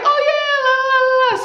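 high sing-song la la la; mocking his answer. Imitating the dude's breezy answer, high and sing-song. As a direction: toss it off airily in a mock high voice. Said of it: "he was like".

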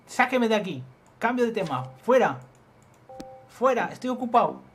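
loud, high-pitched brush-off; irritated dismissal. Acted. Busy man throwing the caller out, irritated shout. Voices character rebuffing the caller.

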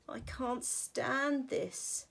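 slow exasperated bear. Acted. Same refrain repeated.

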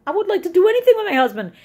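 high, loud, fast and tearful; words spill out in a sob; breaking with longing. Real, not acted. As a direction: voice cracks with longing. Longing for life with husband.

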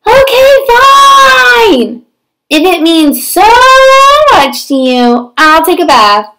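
slow, grudging give-in; reluctant pigeon. Acted. Pigeon grudgingly agrees to bathe, pouting.

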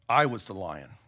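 low, solemn lion's voice; solemn revelation. A narrator's reading. Lion revealing himself to the boy, solemn and low. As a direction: drop the pitch for a grave reveal.

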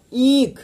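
ईक with a long stretched ee; drawn out. Shown as a demonstration. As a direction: stretch the vowel of ईक out, loud and clear. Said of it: "ईक खींच के बोलते हैं".

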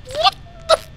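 sudden high-pitched shock; startled. Real, not acted. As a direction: caught off guard by a scare. Jump scare in game.

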